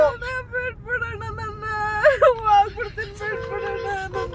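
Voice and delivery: tearful real begging; crying. Real, not acted. Captive begs kidnapper to let her go, crying. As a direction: plead through tears for release. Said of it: "ಹಂಗ್ ಅಳ್ಬೇಡಿ ಅಕ್ಕ".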